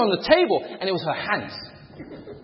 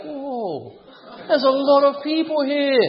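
awed whoa; 8 semitones higher, much slower than usual. Retold or imitated. Sees auditorium of 800 people.

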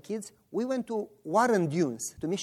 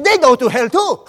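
loud, higher-pitched indignant cry; triumphant protest; 6 semitones higher, 14 dB louder than usual. Retold or imitated. Boy challenging the pastor over the disciples, loudly indignant. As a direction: burst out with a gotcha retort.

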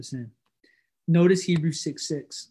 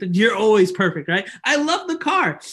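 loud, high burst of adoration; talking to his car, gushing loudly and high up; gushing over the car; 4 semitones higher, 6 dB louder than usual. Retold or imitated. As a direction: rave about the car, much higher.

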